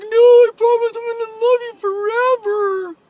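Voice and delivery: high, loud tearful promise; retold bawling plea. Retelling crying a promise to her boyfriend. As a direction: sob out the promise, pleading. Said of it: "bawling that he would even think this".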